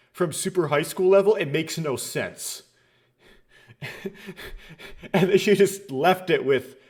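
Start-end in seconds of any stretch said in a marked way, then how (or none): 3.8-5.1 soft, voiceless laugh; breathy chuckle. Real, not acted. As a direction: chuckle quietly under the breath. Amused at nonsensical translation change.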